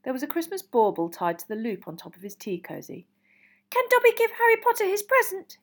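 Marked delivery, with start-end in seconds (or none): 3.7-5.6 tentative, very high elf squeak; Dobby. A narrator's reading. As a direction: squeak it as a nervous request. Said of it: "he squeaked tentatively".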